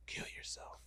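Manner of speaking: breathy unvoiced whisper. Imitating the voice that whispered to him on the drive, in a whisper. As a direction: whisper it like a creepy voice in his head. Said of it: "it's whispering".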